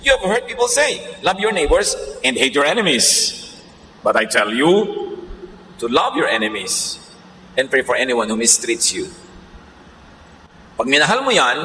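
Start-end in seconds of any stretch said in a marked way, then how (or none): 0.1-9.1 scripture reading. Acted. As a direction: read each verse deliberately, for the congregation. Reads Jesus' words.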